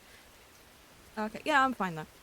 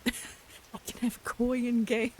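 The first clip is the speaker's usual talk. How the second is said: slow, loud, higher, laughing delight; gleeful drawn-out repeat; excited squeal; 4 semitones higher, 6 dB louder, much slower than usual. Real, not acted. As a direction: stretch it out, giggling with glee. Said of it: "Oh my god, I can have koi in game".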